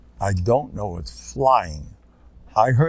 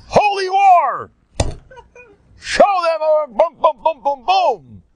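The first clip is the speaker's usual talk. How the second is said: higher, louder hammed-up battle chant; mock war cry; 6 dB louder, slower than usual. Preacher mocking a jihadist, yelling holy war with booms. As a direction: bellow it like a fanatic rallying a crowd.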